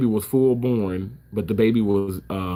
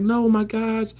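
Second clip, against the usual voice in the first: mock tearful cry; 11 semitones higher, slower than usual. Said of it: "I started crying".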